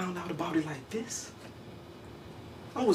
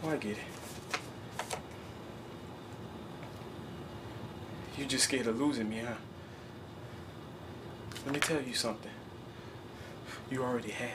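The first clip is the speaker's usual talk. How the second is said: cold bitterness; 10 semitones lower, 11 dB quieter than usual. Acted. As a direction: sneer quietly at her. Son turns cold and bitter.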